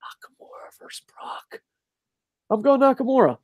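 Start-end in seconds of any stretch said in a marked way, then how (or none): 0.0-1.6 hushed, voiceless whisper; awed little whisper. Real, not acted. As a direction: whisper it to yourself. Repeats the match in a whisper.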